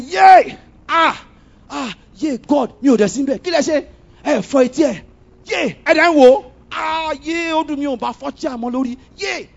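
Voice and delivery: high, loud excited yelling in Yoruba; joyful shrieks and shouted Yoruba; retold shouting and screaming. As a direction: scream it out like you're celebrating in the car. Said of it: "I was shouting and screaming in the car".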